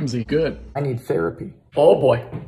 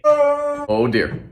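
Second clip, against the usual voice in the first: ordinary talk, nothing marked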